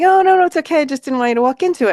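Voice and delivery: retold reassurance. Explaining to the startled tourist, in hurried high reassurance.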